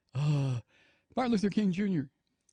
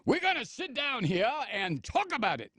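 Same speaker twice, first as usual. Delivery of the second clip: high, swooping hammed-up oration; mock assembly speaker; 7 semitones higher than usual. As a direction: summon the assembly with exaggerated pomp. Parodies officials addressing the campus assembly.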